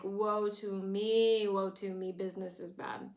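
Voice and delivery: a theatrical moan of self-pity; mocking melodrama; mock lament. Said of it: "and Lorelai's like".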